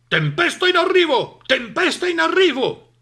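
loud, high barky dog voice; barking dog. A narrator's reading. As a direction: bark the warning urgently. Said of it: "abbaiò Cane". (Cane che abbaia l'allarme)

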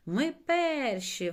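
high, slow, loud cheer; elated squeal. A narrator's reading. Чорник leading the race, squealing with delight. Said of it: "верещав у захваті чорник".

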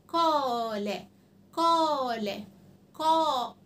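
"قال" repeated slowly with the alif held long; long madd sound. Shown as a demonstration. Teacher drilling children three times, long vowel held. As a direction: draw out the "قا" every time. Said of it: "قولوها ثلاث مرات الأول".

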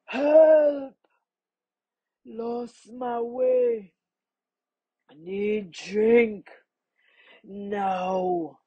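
slow, laboured creature voice; thirsty crocodile. A narrator's reading. Parched crocodile begging the man for help, slow and laboured. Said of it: "he heard a deep voice".

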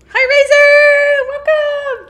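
high, slow, loud sing-song hello; stretched cheery welcome; excited greeting. Real, not acted. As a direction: greet him like a game show host. Greets character in game.

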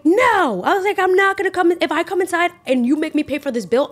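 flat-out refuse the boss, heated; defiant no. Retold or imitated. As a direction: server refusing her manager's order, a heated loud no. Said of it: "And I'm like".

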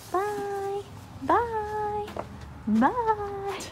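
high cooing byes; sing-song goodbye. Real, not acted. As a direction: coo goodbye to the horses. Repeated goodbyes to horses.

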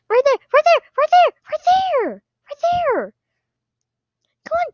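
high, loud repeated right there; frantic pointing yells. Real, not acted. Player yelling directions to the oxygen masks. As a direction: shout to guide the team.

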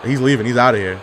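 ordinary delivery

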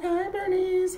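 bright high sing-song hello; nurse's greeting. Retold or imitated. Nurse greeting patient Bernice in a high sing-song voice. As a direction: chirp a friendly hello to the patient. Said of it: "She was like".

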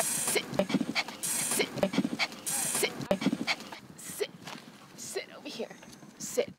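soft whispered command. Real, not acted. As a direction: whisper it gently so the dog isn't startled. Coaxing nervous dog to sit.

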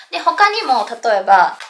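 normal talking voice

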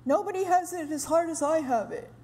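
fast, lightly voiced martyr complaint; self-pitying martyr. Women feeling sorry for themselves, a lightly mocking martyr whine. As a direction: mildly ham up the put-upon sigh. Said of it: "behave like we're martyrs".

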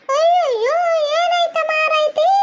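high, drawn-out cries of dismay; alarmed wail. Acted. Onlooker seeing a man fall, high slow wail. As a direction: wail in shock, stretching every word.

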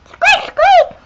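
high squeaky toy mouse cries; mouse squeaks. Acted. Mouse toy squeaking at the visitor, high squeaks.

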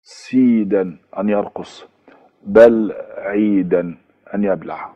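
سيدا and بل عيدا spelled out slowly, ending in ‑يدا; slow classroom demo of the ‑يدا ending; Persian verb endings. Shown as a demonstration. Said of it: "عشان يبقى آخرها دال ونون". (عرض بطيء لنهاية ‑يدا)

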